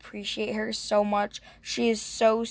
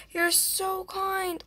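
gushing; 9 semitones higher, 4 dB quieter, much slower than usual. Real, not acted. Gushing over giver.

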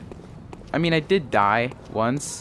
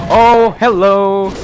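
loud, high, drawn-out hello; big stretched-out greeting to a sudden threat; startled greeting; 7 semitones higher, 12 dB louder, slower than usual. Real, not acted. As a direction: call out slowly in alarmed surprise. Enemy suddenly appears.

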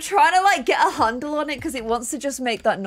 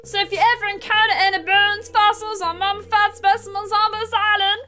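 high, put-on Scottish professor; Scottish-sounding character voice; 6 semitones higher than usual. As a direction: read it high in a hammed-up Scottish accent. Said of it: "It doesn't sound like Shrek. He sounds Scottish".

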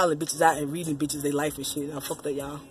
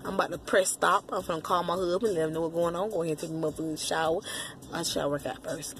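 ordinary talk, nothing marked

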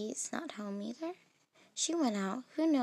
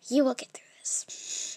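little inner voice. Acted. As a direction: whisper it like a voice inside her head. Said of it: "a little voice said to me".

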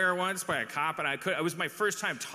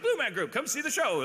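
mock commercial testimonial, pitched up high; theatrical testimonial; 10 semitones higher than usual. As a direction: gush like an audience member in an ad. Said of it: "they do the theatrical testimonials".

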